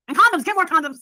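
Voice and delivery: acted-out demand. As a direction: call out the order, loud and high. Acting out the man's demand.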